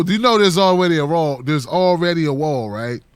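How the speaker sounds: ordinary talk, nothing marked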